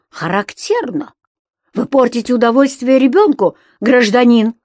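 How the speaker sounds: ringing indignant rebuke; crisply hammered-out words. Acted. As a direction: enunciate each word in a ringing voice. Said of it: "звеняще отчеканил".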